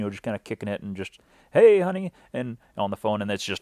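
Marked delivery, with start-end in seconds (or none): 1.5-2.1 much higher, loud sing-song "Hey, honey"; mock casual greeting. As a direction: greet her as if all is calm. Retelling casual phone call.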